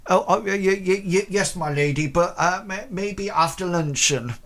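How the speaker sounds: high, loud nervous stammering servant; flustered stammer. Acted. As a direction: stammer it out like a startled maid. Timid servant Mackenzie answers lady.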